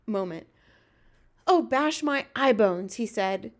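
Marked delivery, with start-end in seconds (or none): 1.5-2.9 slow, loud cry of distress. A narrator's reading. Giant curses his bad luck, distressed. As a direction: wail the oath out. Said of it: "getting more distressed every moment".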